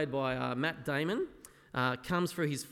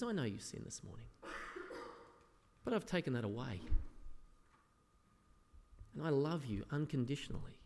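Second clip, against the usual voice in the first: softer, gentle voice of a loving Jesus; tender reassurance; 6 dB quieter than usual. Retold or imitated. Jesus gently assuring believers of his love, softly tender. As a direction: soothe a doubting child.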